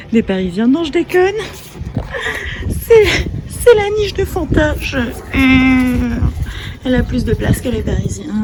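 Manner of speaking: laughing through the words, high and loud; laughing loudly. Real, not acted. Joking about the maid's room, laughing hard. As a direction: giggle it out, cracking up.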